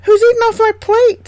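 very high, loud squeaky dwarf; a tiny dwarf upset about his plate; indignant dwarf. A narrator's reading. Second dwarf checks his plate, very high loud dwarf voice. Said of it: "said the second".

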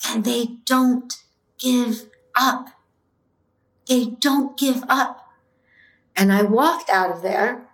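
slow, moved, holding back tears; getting emotional. Real, not acted. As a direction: let it catch in the throat a little. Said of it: "Sorry, I get a little emotional".

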